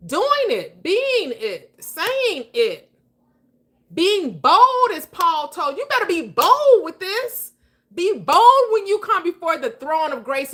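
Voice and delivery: rising, loud preacher's push; fired-up pulpit exhortation; preaching at full force. Acted. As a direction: build it up like a sermon.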